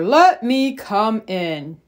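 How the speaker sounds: slow, measured bear request. Acted. Bear asks the animals in the mitten, slow bear voice. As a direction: ask slowly, each word drawn out. Bear's request beside mouse's same request.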